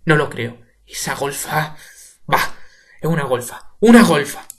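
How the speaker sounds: loud bitter outburst building to a shout; pained. Acted. Father spits insults at his daughter, pained shouting.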